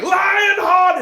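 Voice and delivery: high, loud battle call; hammed-up movie shout; acted rallying shout. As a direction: yell it out like a ringside fan. Acting out the film shout.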